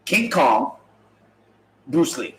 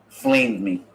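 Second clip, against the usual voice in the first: ordinary delivery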